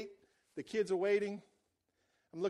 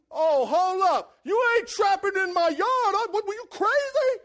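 angry shout; 9 semitones higher, 9 dB louder, slower than usual. Retold or imitated. Confronting a trapper on his land.

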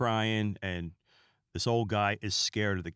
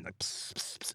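soft hissing spray-can sound; spray noise; 3 semitones lower, 11 dB quieter than usual. Retold or imitated. Imitating the cat spraying, hissed spray-can sounds. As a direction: mimic a paint can spraying.

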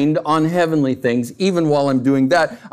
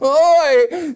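mock exasperated cry; 11 semitones higher, 3 dB louder than usual. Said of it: "I'm like".

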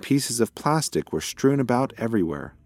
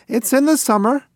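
even higher, pleading repeat; more insistent; 6 dB louder than usual. Acted. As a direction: say it again, begging to be heard.

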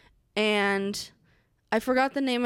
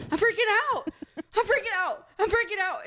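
high-pitched panicked yelp; mock screaming; 8 semitones higher than usual. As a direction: squeal it out in a frantic burst. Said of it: "and I just start screaming".

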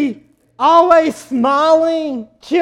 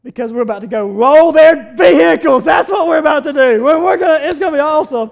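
louder, gleeful scheming; mischievous excitement; 2 semitones higher, 6 dB louder, faster than usual. Retold or imitated. Husband texts wife about rolling trucks, gleeful and loud. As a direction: sell the prank plan with glee. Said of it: "I said".

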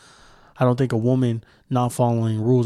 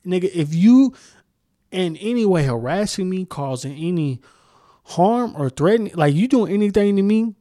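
normal talking voice

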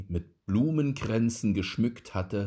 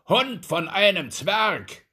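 high, loud furious duke; angry shout; 11 semitones higher, 7 dB louder than usual. A narrator's reading. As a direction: bark the insult at the dwarf. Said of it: "rief er".

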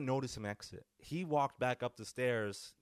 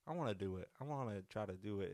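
usual speaking voice

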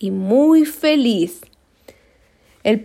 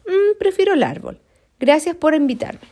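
timid koala excuse; 6 semitones higher than usual. Acted. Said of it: "Se excusó".

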